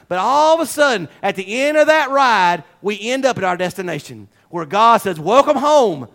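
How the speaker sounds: loud, rising preacher's cry; full-force preaching. Acted. Pastor urging his flock on, full-force preaching. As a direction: preach it out to the congregation.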